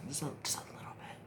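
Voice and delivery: soft whisper while concentrating; hushed little whisper; whispered aside. Real, not acted. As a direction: breathe it out as you dab.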